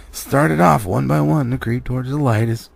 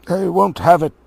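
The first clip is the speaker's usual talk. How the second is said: louder, higher refusal; mildly indignant troll; firm refusal; 8 semitones higher, 7 dB louder than usual. Acted. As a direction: put your foot down. William defending Bilbo.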